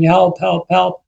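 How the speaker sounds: half-voiced calls for help, pitch lifted; retold shout. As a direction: imitate shouting for rescue without the volume. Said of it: "He'd been shouting".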